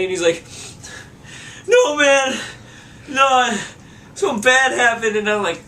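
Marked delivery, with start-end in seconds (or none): 1.7-5.2 put-on higher, louder panicked drunk friend; upset drunk friend. Friend at the door at 2:30am, panicked and higher. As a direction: whine it out like a wrecked drunk buddy. Ryan voiced in retold story.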